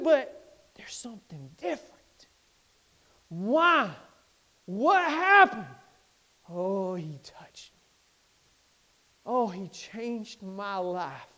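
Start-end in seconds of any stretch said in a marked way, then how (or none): 0.0-2.3 whisper. Retold or imitated. People whispering about him. 6.5-11.3 soft awed testimony. Retold or imitated. Voicing changed man, soft.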